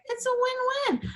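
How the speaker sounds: high-pitched, lightly delivered cheerful quote; retold upbeat realization. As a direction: toss it up high, as a happy conclusion. Retold own upbeat reaction to cancer.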